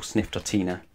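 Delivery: ordinary delivery, nothing marked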